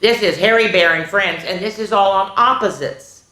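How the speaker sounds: usual speaking voice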